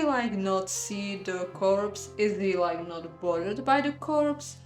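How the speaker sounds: plain reading voice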